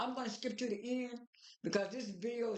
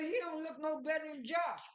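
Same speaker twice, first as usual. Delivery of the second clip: higher-pitched, half-shouted protest; emphatic retort; 6 semitones higher than usual. Retold or imitated. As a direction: exclaim it in high-pitched disbelief. Own reaction, voiced emphatically.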